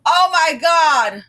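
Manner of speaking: shocked. Real, not acted. Reacts to stock price.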